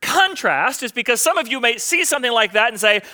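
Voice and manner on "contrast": "contrast" set apart and raised in pitch; stress on contrast. Real, not acted. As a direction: pause before "contrast", then hit it high. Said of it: "why I stress the word contrast".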